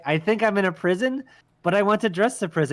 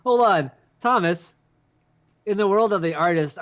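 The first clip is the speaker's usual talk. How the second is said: usual speaking voice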